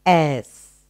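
ordinary delivery

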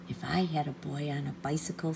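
dreamy whispered wish. Acted. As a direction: whisper it to yourself. Petey wishing to himself.

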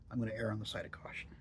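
quick hushed whisper. Real, not acted. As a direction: whisper it fast to yourself. Cautious aside while pinching snuff.

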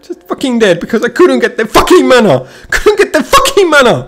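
laughing through the rant; bitter laugh. Real, not acted. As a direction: crack up in disbelief while complaining. Laughing at own bad luck.

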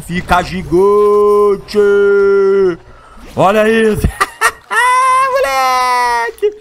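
high, stretched, laughing; squeaky excited. Real, not acted. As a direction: stretch it with a giggle. Giant shark hype.

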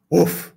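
short storybook dog woof; one quick voiced woof; dog bark. A narrator's reading. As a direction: imitate a big dog's single bark. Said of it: "barked Clifford".

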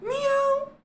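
squeaky, very high meow; high, cute cat meow. Pretend. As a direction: mew like a cat in a tiny voice. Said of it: "You could call me a cat".

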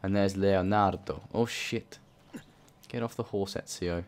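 usual speaking voice

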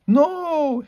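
high-pitched, drawn-out cry of refusal; shouted protest. A narrator's reading. Harry and Hermione refusing Ron's sacrifice, shouted in protest. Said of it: "Harry and Hermione shouted".

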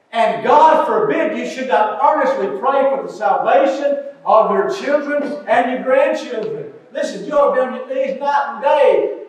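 loud, pounding revival preaching; fervent preacher calling for prayer; full-force preaching. Acted. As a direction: preach it hard to the congregation.

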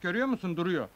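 usual speaking voice

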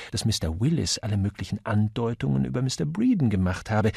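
ordinary delivery

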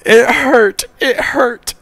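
high, loud mock wail; crying out. A narrator's reading. Stabbed grandson saying it hurt, high wail. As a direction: cry it out twice in a high voice. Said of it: "Caillou cried".

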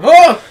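sudden loud high yelp; startled cry. Pretend. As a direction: yelp as if hurt. Patient cry in medieval surgery.

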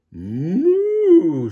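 high, loud stretched moo. A narrator's reading. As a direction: hold the moo long and high. Cow noise in story.

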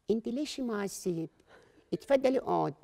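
soft, slow official lady; calm official voice. Acted. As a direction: talk down calmly. Agency director voice.

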